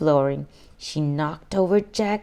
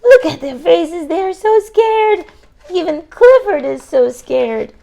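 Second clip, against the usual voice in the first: excited reading; 10 semitones higher, 10 dB louder than usual. Acted. Reader excited over picture.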